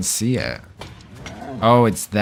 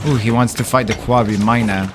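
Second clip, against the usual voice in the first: usual speaking voice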